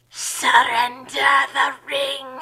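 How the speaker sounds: slow, menacing Witch King voice. Acted. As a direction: drag out each word like a ringwraith lord. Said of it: "the Witch King said".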